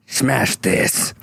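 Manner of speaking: hero's tough battle line; lightly put-on deep action hero. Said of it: "And my voice is deeper now".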